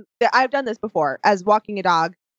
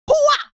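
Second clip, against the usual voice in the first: high, loud battle cry; hammed-up martial arts yell; mock karate yell; 14 semitones higher, 3 dB louder than usual. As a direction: shriek it like a cartoon fighter. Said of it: "And I was like".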